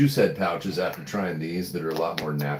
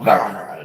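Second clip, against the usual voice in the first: louder mock growl of a dog going for others; imitated dog growl; 7 dB louder than usual. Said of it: "he's all".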